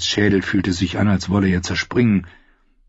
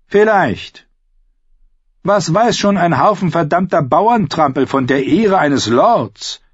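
higher, scornful retort; sardonic outlaw; 9 semitones higher, 4 dB louder than usual. Acted. Outlaw scorns the captive's talk of honour, sardonic. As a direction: answer with mocking mock-humility.

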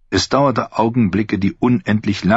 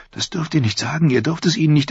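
higher, rushing fast thought; anxious inner plea; 8 semitones higher, faster than usual. A narrator's reading. Sansa silently begging Petyr, hurried and higher. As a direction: tumble the words out in silent panic. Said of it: "dachte Sansa".

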